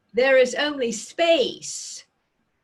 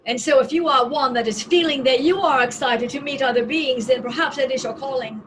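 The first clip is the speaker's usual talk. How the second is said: excited; 9 semitones higher, 4 dB louder, much faster than usual. Acted. Uplifting excited message.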